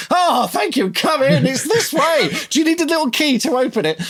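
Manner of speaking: high, gushing, overeager welcome; breathless high mock welcome; gushing host. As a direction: fawn over the meter reader like a long lost lover. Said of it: "greeted him like a long lost lover".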